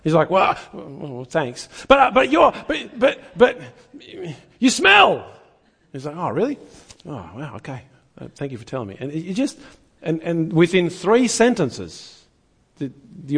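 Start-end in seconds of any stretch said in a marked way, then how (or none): 0.3-5.2 flustered stammer. Retold or imitated. Girl runs out of insults. 6.2-9.0 much softer, unbothered thank-you; calm gentle thanks. Retold or imitated. Man answers insult gently.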